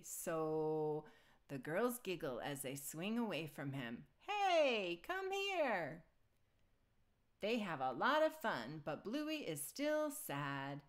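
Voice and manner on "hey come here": high, loud playful call. A narrator's reading. Dad calling kids swinging away, playful call. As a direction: call after them in mock protest.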